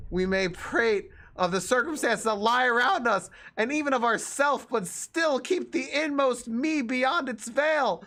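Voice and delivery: high-pitched, strained mock-dramatic read; exasperated reading. Exasperated reader pushes through endless text.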